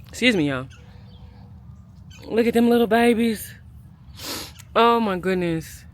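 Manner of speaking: ordinary talk, nothing marked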